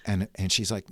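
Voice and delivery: normal talking voice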